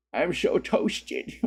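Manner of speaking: high, very slow slurred silly voice; silly toasted voice. Pretend. Streamer joking he was turned into toast, silly slow voice. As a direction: draw it out like a goofy drunk.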